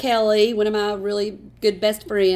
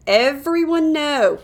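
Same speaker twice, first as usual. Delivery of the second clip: normal talking voice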